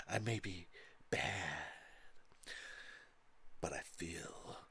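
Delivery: hushed, embarrassed delivery; quiet line reading. Retold or imitated. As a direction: whisper the line like a reluctant actress. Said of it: "She's like".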